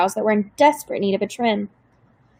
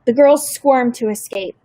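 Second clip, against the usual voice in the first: plain reading voice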